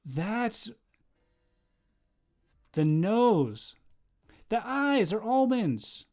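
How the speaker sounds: loud, high, drawn-out disbelief; shocked amazement. Real, not acted. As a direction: exclaim it slowly, aghast at what you see. Reacting in shock to a character picture.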